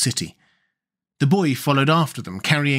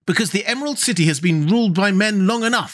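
put-on high, proud voice of a girl general; high-pitched young general, proud; General Ginger; 6 semitones higher than usual. A narrator's reading. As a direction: boast of your secret army. Ginger explaining her reasons.

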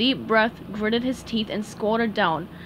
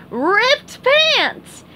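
high, loud goofy exclamation; cartoon sponge crying out the gag; cried out; 13 semitones higher, 6 dB louder than usual. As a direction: belt it out like a punchline. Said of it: "he cried".

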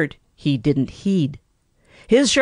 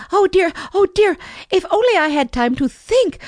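high, panting little mouse voice in a fright; panting frightened mouse; 13 semitones higher than usual. A narrator's reading. Mouse fleeing the foxes, panting high and scared. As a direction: gasp it out like a hunted mouse. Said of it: "panted Danny Meadow Mouse".

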